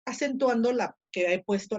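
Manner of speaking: ordinary talk, nothing marked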